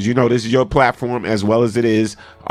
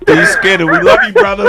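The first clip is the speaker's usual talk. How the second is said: high, loud hyped shout out; 13 semitones higher, 8 dB louder than usual. Real, not acted. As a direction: call it out big to the caller. Shout out to caller.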